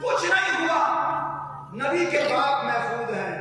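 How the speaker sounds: preaching at full force. Acted. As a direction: proclaim it to the gathering. Preaching a story, high-pitched. (Josheela was)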